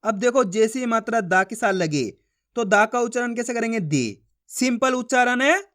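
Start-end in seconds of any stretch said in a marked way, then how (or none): 3.8-4.2 दी said short and low, a brief ee; short i sound demo. Shown as a demonstration. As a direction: clip the vowel of दी short. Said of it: "छोटी ई का उच्चारण जोड़ना है". (दी छोटा और नीचा बोलें)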